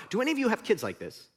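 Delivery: ordinary talk, nothing marked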